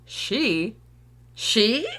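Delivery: loud, incredulous one-word challenge. Acted. Parent hearing the therapist is a woman, incredulous. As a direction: pounce on it in disbelief.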